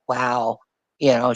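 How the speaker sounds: usual speaking voice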